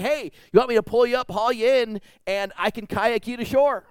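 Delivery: eager kayaker. Retold or imitated. Kayaker offering to tow the man, eager. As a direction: rattle it off like a helpful kayaker. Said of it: "he's like".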